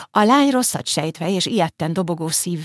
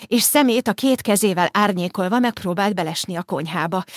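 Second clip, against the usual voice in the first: usual speaking voice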